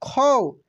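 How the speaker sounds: खौ drawn out slowly with a firm open औ; slow stressed au. Shown as a demonstration. Teacher repeating खौ slowly for learners, stressed औ. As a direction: stretch खौ and press on its औ. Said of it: "जोर लग रहा है इस पे".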